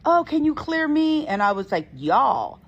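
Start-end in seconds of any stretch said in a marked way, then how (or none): 0.0-1.2 high, eager mock voice; eager students. Teller mimics classmates asking to be cleared. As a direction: imitate the eager classmates, pitched up. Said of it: "a few people in that class who were like".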